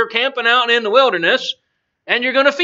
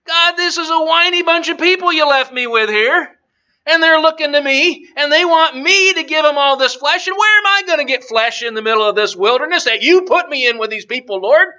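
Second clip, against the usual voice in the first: high, aggrieved moaning to God; whiny complaining; 6 semitones higher, 4 dB louder than usual. Acted. Moses complains to God about the people, whiny complaining. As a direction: complain up to heaven, pitch raised. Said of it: "You can hear him complaining".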